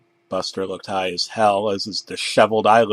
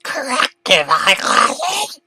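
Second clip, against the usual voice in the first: high slow shriek; scream; 14 semitones higher, slower than usual. Acted. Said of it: "Screamed some more".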